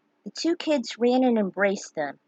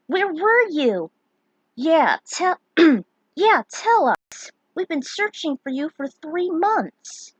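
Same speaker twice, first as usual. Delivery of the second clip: eager high voice; 8 semitones higher, 5 dB louder, slower than usual. Acted. Friends voiced, demanding answers.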